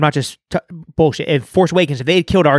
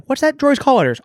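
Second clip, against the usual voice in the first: high, playful quoted question; high mock announcer; 9 semitones higher than usual. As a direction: ask it like an excited fan. Crowd asks droid name.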